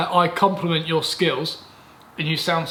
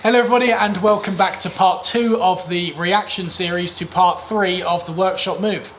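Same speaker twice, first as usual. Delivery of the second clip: mock YouTube intro; 2 semitones higher, 5 dB louder than usual. Mock video intro while laughing.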